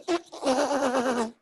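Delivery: breathy mock laugh; mock laughter; demo laugh. Shown as a demonstration. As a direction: act out another kind of laugh. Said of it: "Some people".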